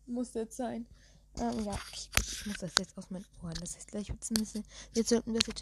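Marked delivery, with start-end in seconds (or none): none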